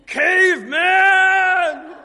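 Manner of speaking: playful shout from the congregation at the preacher; heckling shout. Real, not acted. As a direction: yell it out slowly across the hall. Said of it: "your wife".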